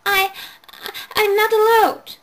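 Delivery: put-on high, stammering little girl; plead, frightened and small; scared child. A narrator's reading. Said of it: "Chloe called".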